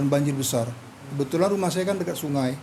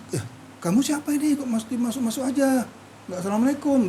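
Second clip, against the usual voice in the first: higher-pitched retold reproach; indignant challenge; 7 semitones higher than usual. Dreamer confronts a stranger walking in without greeting, indignant challenge. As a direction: act out questioning an uninvited visitor, pitch raised.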